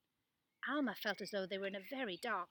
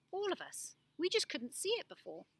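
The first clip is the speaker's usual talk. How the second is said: heartfelt realization; 9 semitones higher than usual. Acted. Alma's realization.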